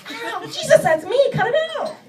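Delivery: high laughing ow; sudden yelp. Retold or imitated. Wife plucking her own chin hair, a high laughing yelp.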